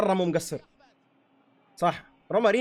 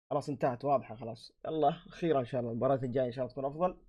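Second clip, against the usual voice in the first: quiet sign-off; 4 semitones lower, 9 dB quieter, faster than usual. Real, not acted. Signing off the video.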